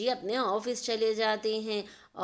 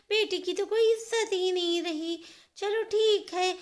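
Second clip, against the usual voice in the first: put-on much higher, louder sulky young woman; grumbling spoilt daughter; 8 semitones higher, 4 dB louder than usual. Neha waking late and grumbling to herself, high and sulky. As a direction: huff to yourself, offended nobody fed you.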